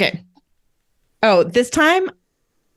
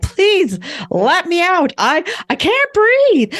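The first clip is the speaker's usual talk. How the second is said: high, slow, hammed-up panic; melodramatic losing it; 11 semitones higher, slower than usual. Fake panicking guest begs to be let out, melodramatic wail. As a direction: plead as if about to faint, overdone and high-pitched. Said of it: "making it sound like, uh, I'm losing it".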